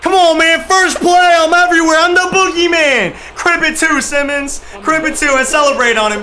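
high, loud, exultant yelling; hyped shouting. Real, not acted. Gamer hypes his defense after a first-play stop, shouting. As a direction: yell it like you just made the big stop.

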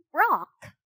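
high croaked bird name; croaking. A narrator's reading. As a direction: croak it like a raven. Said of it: "the raven croaked".

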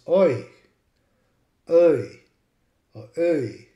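oi, schwa or O gliding to ee; regional long I. Shown as a demonstration. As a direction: say oi in varied regional shades for I. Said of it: "I, in many places".

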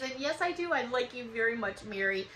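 ordinary delivery

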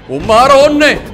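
loud, high startled cry; startled exclamation. Real, not acted. Player reacting to the werewolf, startled.

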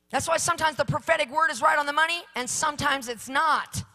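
loud, rising preacher's fervour; fiery revival preaching; full-force preaching. Acted. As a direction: preach it, climbing to a shout.

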